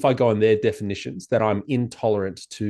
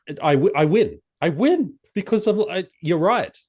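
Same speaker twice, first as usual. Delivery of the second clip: usual speaking voice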